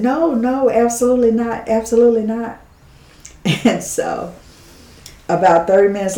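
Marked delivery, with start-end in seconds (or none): 0.0-2.6 high, firm no, no, absolutely not; emphatic refusal. Retold or imitated. Turning away the visitors, a high emphatic refusal. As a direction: shut the request down firmly. Refusing the Miami prayer group.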